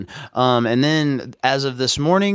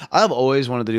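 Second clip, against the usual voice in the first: ordinary talk, nothing marked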